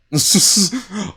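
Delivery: sudden high laugh; loud burst of laughter. Real, not acted. Reacts to being called sus.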